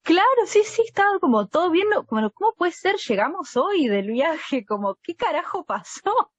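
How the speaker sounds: high, shocked disbelief; incredulous friends. Retold or imitated. Friends can't believe the couple split, shocked. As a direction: voice the baffled friends.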